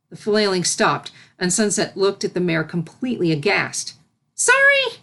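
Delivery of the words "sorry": high, loud startled apology; high cry. A narrator's reading. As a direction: blurt it out in shock. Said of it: "she cried".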